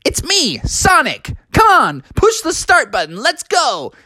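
high, eager cartoon hero voice; peppy game character, high; Sonic. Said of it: "And he said".

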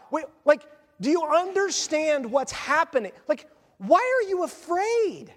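high-pitched, incredulous pleading; exasperated Moses. Acted. Moses challenging the frightened people, exasperated disbelief. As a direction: plead in disbelief, stretching the key words.

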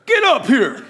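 loud mock summons; playful commanding shout; bold command. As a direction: call someone up boldly. Acts newly bold command.

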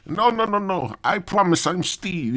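high, protesting mock Indian accent; badly faked Indian accent. Caller insisting he is Steve, high fake Indian accent. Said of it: "I can't even do the fucking accent".

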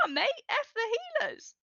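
high, smug taunt; gloating mocking jeer. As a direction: throw their words back at them. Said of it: "and I was like".

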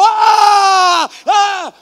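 loud high triumphant yell; cheering yell. Retold or imitated. Preacher imitating his own cheer when the Knicks led, loud. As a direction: cheer on your team alone.

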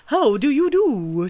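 loud, drawn-out pompous hail; stately owl hooting hello; Owl's greeting. A narrator's reading. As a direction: call it out high and slowly as a self-important owl.

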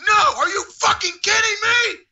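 very high, incredulous shout; high-pitched retold fury; imitated furious staffer. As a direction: act out an outraged yell. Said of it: "He went".

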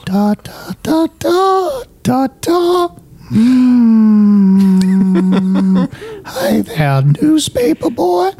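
put-on high, wavering creepy old man; Herbert impression. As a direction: coo it like a creepy old neighbor. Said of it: "like Herbert from Family Guy".

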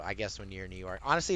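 ordinary delivery

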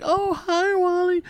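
very high, mocking flirty coo; flirty, giggly woman. Wife mimicking the church clerk's call, flirty and giggly. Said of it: "she's all flirty and giggly".

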